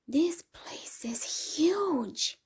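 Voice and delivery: stretched-out wow at a big house; excited exclaim. A narrator's reading. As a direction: gasp it out in awe of the house. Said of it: "exclaimed in unison".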